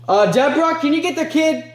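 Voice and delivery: high-pitched call to grandma; calling out for help. Acted.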